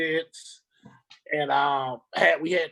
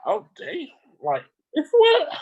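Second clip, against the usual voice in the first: shocked; 9 semitones higher, 4 dB louder than usual. Retold or imitated. Retelling shock at entree.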